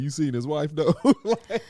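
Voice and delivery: quoted line breaking into laughter; laughing mock gossip. The boys joke about the wife once he leaves, laughing. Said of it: "and they're like".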